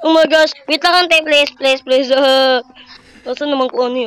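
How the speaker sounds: usual speaking voice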